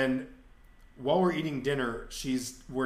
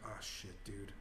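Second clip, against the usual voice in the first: quiet dread; 6 semitones lower, 18 dB quieter than usual. Retold or imitated. Dread on the plane.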